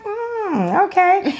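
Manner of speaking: answering her husband's hug with a coy high mm okay. Retold or imitated. Coy high-pitched reply to husband. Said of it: "And I just like".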